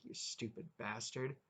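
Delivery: a soft bitter insult under the breath; under her breath. Acted. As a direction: spit it quietly past him. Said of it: "under her breath".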